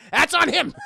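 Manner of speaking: loud, high, laughing through the words; real laughing. Real, not acted. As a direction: blurt it out while cracking up. Laughing at own joke.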